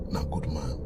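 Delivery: low breathy whisper of praise; "na good man" whispered very low. Real, not acted. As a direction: whisper it, almost no voice.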